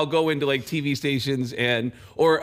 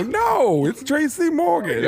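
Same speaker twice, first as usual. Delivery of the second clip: much higher, laughing put-on voice; Tracy Morgan impression; 13 semitones higher, slower than usual. Comic admitting his impression to the TV crew. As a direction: pass the bad Obama off as Tracy Morgan. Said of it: "I go".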